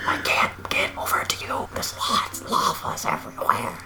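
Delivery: slow hushed whisper. Pretend. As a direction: whisper it like a secret warning. Continuing lava-person whisper.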